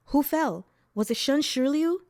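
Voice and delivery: anxious questioning. A narrator's reading. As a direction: demand an answer, fearing for your godfather. Anxious questioning of fleeing bystander.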